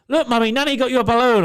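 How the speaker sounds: put-on high, loud, rushed kid voice; gleeful tattling toddler, imitated; excited child impression. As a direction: blurt it like an excited little boy. Child blurts out secret present.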